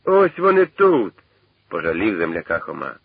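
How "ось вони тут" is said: high, loud, drawn-out holler; calling out. A narrator's reading. Khoma calls the searching Shovkun back, calling out. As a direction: call it across the field, high and slow.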